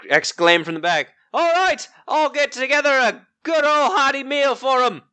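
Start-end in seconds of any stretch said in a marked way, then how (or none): 1.3-5.0 high, far-off cook calling out; gruff tavern cook. Acted. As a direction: call it from the kitchen. Tavern cook calls from kitchen.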